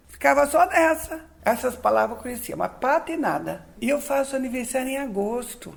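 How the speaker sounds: ordinary delivery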